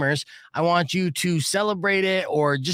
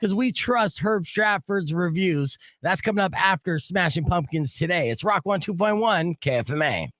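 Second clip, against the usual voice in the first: radio announcer read; 6 semitones higher than usual. Acted. Host plugs the upcoming review, in announcer voice.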